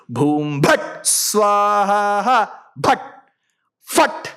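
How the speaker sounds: ritual syllables with a long drawn-out swaha; demonstrating fire-ritual exclamations; ritual sound demonstration. Shown as a demonstration. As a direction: intone the sacred sounds, stretching swaha, then short bursts. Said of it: "we use certain sounds".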